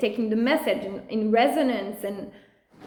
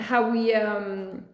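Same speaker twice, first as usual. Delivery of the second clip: usual speaking voice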